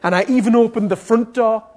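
emphatic shouted retelling; excited retelling. Retold or imitated. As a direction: build her urgency at full volume. Retells her opening every door.

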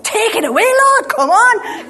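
high loud impatient plea; urgent pleading prayer. Retold or imitated. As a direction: beg God to hurry up. Urgent prayer before leaving.

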